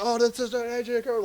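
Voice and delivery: a light imitation of an unbothered host. Host explaining him to audience.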